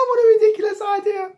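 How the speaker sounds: high, loud, fast derisive cry; laughing woman. A narrator's reading. Woman toppling about at Jack's idea, hysterically amused. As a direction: shriek it in acted hilarity. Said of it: "she was laughing so much".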